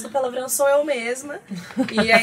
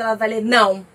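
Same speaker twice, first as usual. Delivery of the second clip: a blunt, louder não; harsh curt no; 7 dB louder than usual. Shown as a demonstration. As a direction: read não as a stressed person hears it, sharp and cold. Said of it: "se ela estiver estressada". (não seco e ríspido, como lido por alguém estressado)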